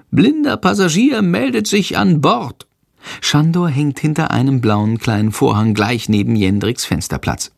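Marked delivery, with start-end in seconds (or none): 0.1-2.7 high-pitched cheeky bat voice; little bat. A narrator's reading. Stowaway bat reporting to the boy, high and cheeky. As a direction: pipe up high like a tiny stowaway. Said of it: "eine vertraute Stimme".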